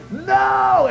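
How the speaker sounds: high, stretched-out wail; retold scream. Preacher re-enacting his panic before the Lord, screamed. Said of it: "And I screamed".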